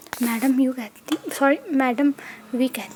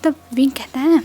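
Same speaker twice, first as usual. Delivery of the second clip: plain reading voice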